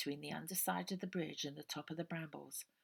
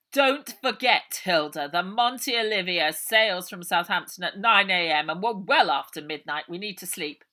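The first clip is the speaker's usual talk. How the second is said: quite loudly; 16 dB louder than usual. A narrator's reading. Uncle Arthur speaking loudly so Ida hears, loud and deliberate. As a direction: say it loud enough for Ida to overhear. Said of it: "and he said quite loudly".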